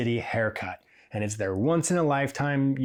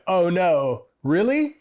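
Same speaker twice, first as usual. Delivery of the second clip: loud, rising higher into really; shocked disbelief; 6 semitones higher, 4 dB louder, slower than usual. Retold or imitated. Learning the client is from a rival town, loud shocked disbelief. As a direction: caught out, voice jumping up in disbelief.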